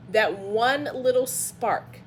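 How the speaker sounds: normal talking voice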